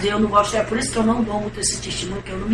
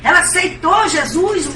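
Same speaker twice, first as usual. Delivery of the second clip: higher, insistent denial; protesting; 8 semitones higher, 4 dB louder than usual. Retold or imitated. Sister Eulene rejecting the claim, high insistent protest. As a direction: refuse to hear bad news.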